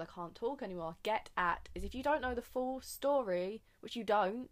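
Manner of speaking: usual speaking voice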